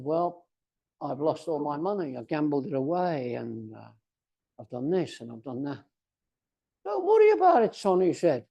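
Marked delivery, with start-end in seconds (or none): 0.0-5.8 low, subdued account of one's failings; retold miserable confession. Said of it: "You look miserable". 6.8-8.0 high-pitched, breezy retold reassurance; lightly voiced old man. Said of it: "An old man sitting on a bench".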